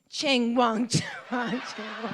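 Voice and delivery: mock foreign-language reply. Imitating woman's reply.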